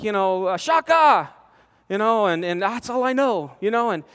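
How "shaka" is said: eager shaka. Retold or imitated. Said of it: "So I'm like".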